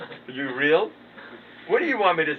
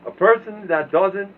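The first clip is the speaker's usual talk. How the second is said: preaching; 6 semitones higher, 8 dB louder than usual. Acted.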